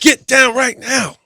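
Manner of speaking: loud, high, drawn-out shout; urgent yell. Retold or imitated. Boxing fan yelling at a kid about to fall, urgent shout. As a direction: yell it across the room to stop a child. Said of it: "I yelled at a kid".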